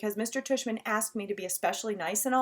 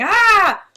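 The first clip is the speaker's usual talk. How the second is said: high, loud startled yelp; kid's scared "ah"; 11 semitones higher, 14 dB louder than usual. Retold or imitated. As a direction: mimic the little brother's cry.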